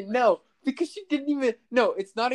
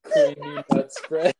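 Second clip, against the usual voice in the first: laughing; 9 dB louder than usual. Real, not acted. Laughing at new name.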